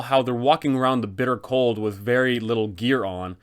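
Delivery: ordinary talk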